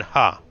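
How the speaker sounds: a single loud clipped laugh; one scornful ha; short mock laugh. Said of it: "I just went".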